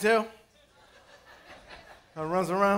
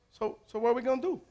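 put-on small kid voice; 9 dB quieter, faster than usual. Godson asks with cute face.

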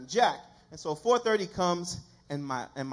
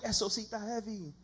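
higher-pitched bilingual catchphrase; Pastor George; 5 semitones higher than usual. Retold or imitated. Preacher mimicking a pastor's bilingual stuff, high-pitched imitation. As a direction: imitate a cool pastor slipping into Spanish. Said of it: "some bilingual stuff, like".

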